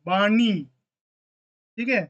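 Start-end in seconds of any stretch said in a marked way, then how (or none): 0.1-0.5 वाणी with ण said retroflex, tongue curled back: vaa-ṇi. Shown as a demonstration. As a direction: say वाणी with the curled-back ṇ sound, not a plain n. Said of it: "यहाँ पे नी वाला जो उच्चारण".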